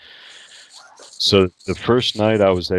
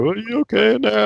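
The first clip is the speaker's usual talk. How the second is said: high-pitched, raised voice through a door; nurse calling out; 5 dB louder than usual. Retold or imitated. Nurse checking on patient in the bathroom, calling out high. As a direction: call out in a high, concerned nurse voice.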